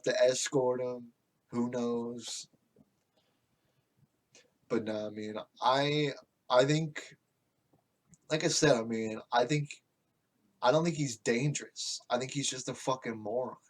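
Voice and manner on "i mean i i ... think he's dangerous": ordinary delivery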